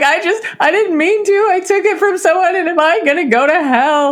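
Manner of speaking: put-on frightened teen asking her dad if she'll go to hell; panicked kid. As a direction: plead like a terrified fourteen-year-old. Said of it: "I'm like".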